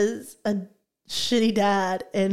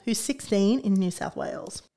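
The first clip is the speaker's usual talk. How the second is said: usual speaking voice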